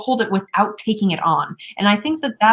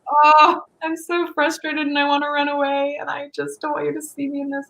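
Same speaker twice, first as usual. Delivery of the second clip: mock frustration; 8 semitones higher than usual. Woman mimicking her own frustration to her boyfriend, mock whiny. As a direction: lightly ham up your own frustration. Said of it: "Or when I'm frustrated".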